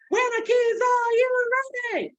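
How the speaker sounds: very high, loud call; teacher call. Shown as a demonstration. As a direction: call out to the class. Call-and-response third time.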